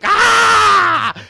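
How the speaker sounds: long, high, loud sustained scream; Michael Cera's yell. Retold or imitated. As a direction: hold one shrill yell for fifteen minutes. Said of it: "Michael Cera going".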